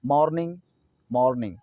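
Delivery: "मॉर्निंग" with an open 'maw'; correct pronunciation. Shown as a demonstration. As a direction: say "मॉर्निंग" as MAWR-ning, lips apart. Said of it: "बल्कि मॉर्निंग है". ("मॉर्निंग" में खुला 'मॉ', 'मो' नहीं)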